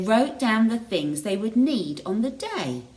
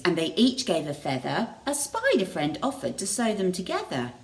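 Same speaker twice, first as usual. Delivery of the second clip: ordinary delivery, nothing marked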